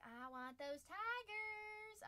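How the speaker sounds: high, very slow mock longing; imitating a viewer. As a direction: draw it out as a wishful fan. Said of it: "Bub's like".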